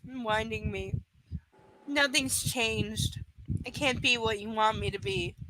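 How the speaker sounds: low, soft, measured reading; subdued first-person narration; story narration. A narrator's reading. Reads novel aloud.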